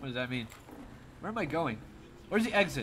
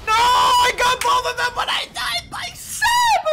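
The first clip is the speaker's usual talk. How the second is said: anguished shout; 14 semitones higher, 8 dB louder than usual. Real, not acted. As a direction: cry out in loud despair. Died after double kill.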